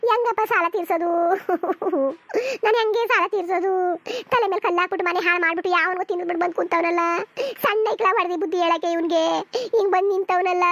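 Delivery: loud, tearful wail; bawling mother's lamentation; wailing lament. Acted. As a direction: cry out in despair over ruin. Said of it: "ಅಳ್ಬೇಡಿ".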